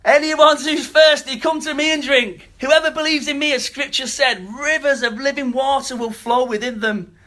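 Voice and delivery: loud, high-pitched proclamation. Acted. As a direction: call it out to the whole crowd, high and forceful. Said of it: "he says in a loud voice".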